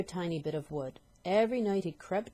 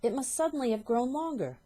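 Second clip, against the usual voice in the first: put-on higher, bewildered old woman; bewildered wife; 7 semitones higher, 3 dB louder than usual. Wife puzzling over the stick, higher and baffled. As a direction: reason it out, baffled. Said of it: "Missus Twit said".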